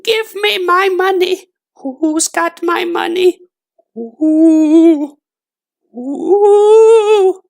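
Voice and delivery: very high, slow, eerie ghostly wail; ghost crying. A narrator's reading. Dead woman's ghost calling for her money, high eerie wail. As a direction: moan like a far-off ghost hunting her money. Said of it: "And the woman cried".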